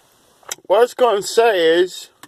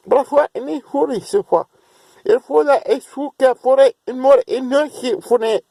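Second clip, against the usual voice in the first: higher, sing-song flow of invented syllables; speaking in tongues; 3 semitones higher than usual. Real, not acted. Praying to God in tongues, higher and fervent. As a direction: pour out tongues as if the angels give the words. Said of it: "I'll give you some foreign language".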